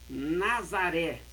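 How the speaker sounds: normal talking voice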